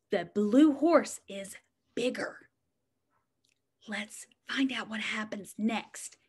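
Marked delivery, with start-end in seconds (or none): 3.9-6.2 whisper. Acted. Suspense before turning page.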